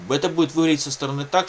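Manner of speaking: normal talking voice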